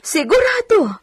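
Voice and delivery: high, choked-up happy cry; so glad she could weep; a joyful sob in the voice; tearful joy. Acted. Maid overjoyed at pregnancy news.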